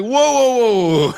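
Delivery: high, loud whooping cry; exaggerated startled holler; mock alarm. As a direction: call it out high and loud, stopping someone. Acted reaction to thick accent.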